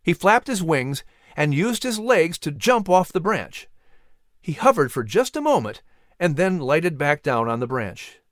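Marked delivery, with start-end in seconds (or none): none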